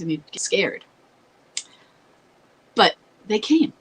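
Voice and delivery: ordinary talk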